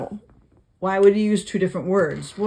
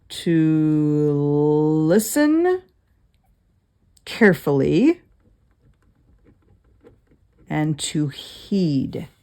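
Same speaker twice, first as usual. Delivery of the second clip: drawn-out, deliberate definition; very slow definition; 3 semitones lower, much slower than usual. Shown as a demonstration. Teacher defining shema very slowly to the class. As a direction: stretch each word out. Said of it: "defining the word slowly".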